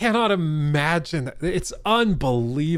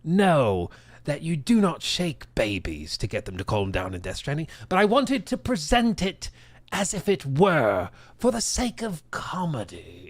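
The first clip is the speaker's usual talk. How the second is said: put-on a fairly light posh British accent. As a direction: play the smug English mastermind in a posh British accent. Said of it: "He's like".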